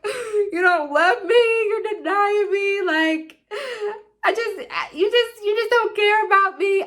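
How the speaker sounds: high, drawn-out mock wail; imitated drunk crying. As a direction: whine it tearfully, like a melodramatic drunk meltdown. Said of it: "Literally, I just start crying".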